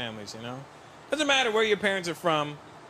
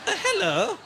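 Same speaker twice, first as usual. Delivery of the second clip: put-on high, hesitant Eastern European accent; timid Eastern European hello; Eastern European lady; 12 semitones higher, slower than usual. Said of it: "some Eastern European lady answered".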